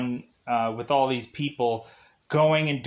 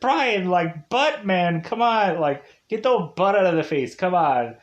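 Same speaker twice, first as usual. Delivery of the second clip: raised, mock-pleading whine; teasing complaint; 5 semitones higher, 3 dB louder than usual. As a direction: playfully scold a friend. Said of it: "I'm just like".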